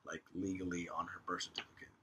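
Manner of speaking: hushed, secretive whisper. Real, not acted. Host whispering gossip to cohost. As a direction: lean in and whisper the juicy part.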